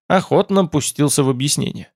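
usual speaking voice

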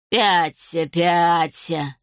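drawn-out menacing hiss of a crone; hissing old woman. A narrator's reading. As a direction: hiss it out as a command. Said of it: "прошипела старуха". (зловещее шипение старухи)

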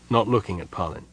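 plain reading voice

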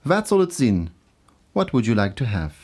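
ordinary talk

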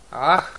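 a loud exhausted moan. A narrator's reading. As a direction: groan out the "ah". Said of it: "простонала Аннабет".

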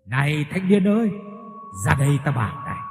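lightly voiced mysterious caller; a hint of a ghostly summons by the ear; calling voice. A narrator's reading. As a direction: call the young man over. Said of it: "bỗng có tiếng gọi ở bên tai".

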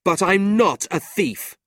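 loud, slow proud insistence. A narrator's reading. Nicholas proudly insists to his uncle. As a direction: stand tall and insist. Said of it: "staring proudly at his uncle".

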